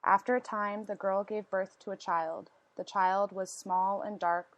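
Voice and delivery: ordinary delivery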